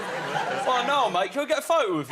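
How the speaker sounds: high, loud mock fan voice; hammed-up eager bloke asking for a photo; pushy fan. Retelling a fan at a football game toilet, mock eager.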